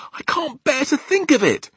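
very high, loud cry of anguish; distressed cry. A narrator's reading. Sophie can't bear the giant's news, crying out. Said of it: "Sophie cried".

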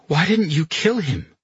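high-pitched incredulous question; disbelief. A narrator's reading. Carletto questions Pino for sparing the general, in disbelief. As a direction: ask it as if you cannot believe it. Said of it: "Carletto said in disbelief".

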